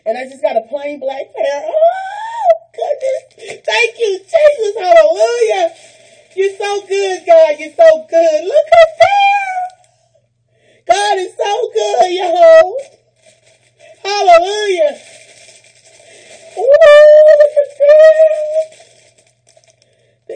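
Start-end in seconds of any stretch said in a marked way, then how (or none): 1.6-15.0 high, loud, tearful hallelujahs; crying praise. Real, not acted. Thanking Jesus for the gifts, crying praise. 16.6-18.8 high, loud, slow squeal of delight; thrilled squeal. Real, not acted. Seeing matching earrings, thrilled squeal.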